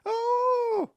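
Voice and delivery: high, loud "oh" squeal; startled grandmother noise. Retold or imitated. Grandson mimics the noise his blind grandmother made, high squeal. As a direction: imitate a blind old woman's loud, high cry. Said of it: "That's the noise that came out of her".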